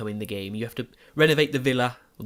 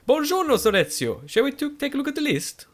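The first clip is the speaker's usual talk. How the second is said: Italian accent impression; 5 dB louder than usual. Gamer imitating the game's architect to viewers, Italian accent. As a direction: mimic the Italian architect greeting in a high Italian accent. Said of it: "who always says".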